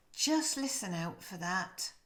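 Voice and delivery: normal talking voice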